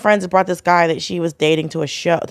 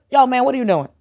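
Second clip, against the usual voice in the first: higher-pitched but casual call-out; toned-down challenge; 6 semitones higher, faster than usual. Retold or imitated. What she really said to the comic, milder challenge. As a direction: question him lightly, without the outburst. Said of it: "'cause I just went".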